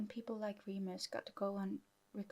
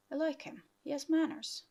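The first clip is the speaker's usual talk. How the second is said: higher, louder teasing barman; 6 semitones higher, 8 dB louder than usual. Acted. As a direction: poke fun at him, barman-style.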